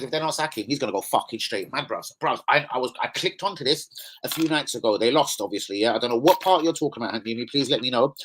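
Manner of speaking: normal talking voice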